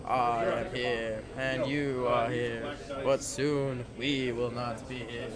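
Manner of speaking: slow, low, drawn-out movie line; a hammed-up slow Princess Bride line; Princess Bride impression. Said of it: "my terrible Princess Bride impression".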